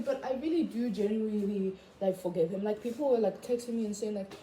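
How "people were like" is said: ordinary talk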